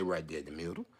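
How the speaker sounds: very soft, low aside; acting out a quiet, low-voiced tip; barely voiced, deep and soft; hushed instruction. Quietly voiced instruction from French Fry.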